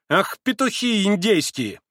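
high, slow mockery; scornful exclamation. A narrator's reading. Grushenka mocking the offended pans, scornful exclamation. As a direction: toss the insult out, stretched.

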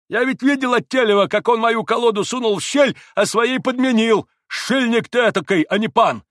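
high, loud accusing voice; heated accusation; angry denunciation. A narrator's reading. Landlord denounces the cheat.

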